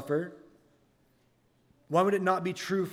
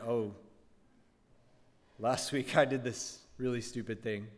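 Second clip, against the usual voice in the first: sheepish realization; 5 semitones lower, 6 dB quieter than usual. Pretend. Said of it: "and then you were like".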